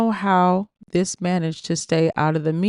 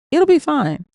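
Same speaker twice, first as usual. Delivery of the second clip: much higher, louder breezy brush-off; dismissive; 11 semitones higher, 5 dB louder than usual. Acted. Sean dismissing his crying partner, breezy brush-off. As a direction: wave her worries away. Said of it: "He verbally waved me off".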